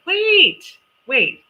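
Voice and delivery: high, loud "wait"; sudden realisation. Real, not acted. Host catching a mistake about the birthday, startled. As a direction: stop yourself short, loud and high.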